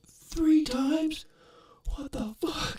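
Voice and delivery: very high, strained, whispery mock yell; half-voiced scream of disbelief, imitated; retold yell. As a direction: imitate a drunk's outraged holler, squeezed thin and high. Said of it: "you can hear him yell".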